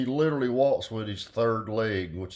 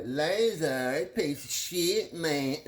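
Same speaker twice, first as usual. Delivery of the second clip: higher mock-complainer voice; mocking commenter; 5 semitones higher, much slower than usual. Imagining viewers saying dumb shit, mocking. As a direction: put on a high griping voice. Mocks what commenters will say.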